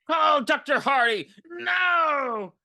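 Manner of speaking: high, loud, slow fake-crying yell; mock panicked shout. Daughter at Granny's heart attack, mock crying shout. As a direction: ham up a frantic cry for help.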